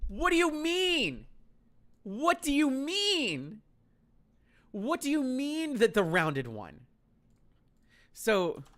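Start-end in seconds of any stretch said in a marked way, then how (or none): 0.1-6.9 raised, stunned questioning; can't believe what you heard; high disbelief. Retold or imitated. Disbelieving friend's answer.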